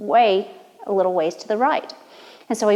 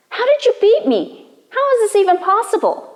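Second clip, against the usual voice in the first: much higher, incredulous voice; stunned disbelief; 12 semitones higher, 4 dB louder than usual. Acted. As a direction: cry out in disbelief. Fox stunned at losing again.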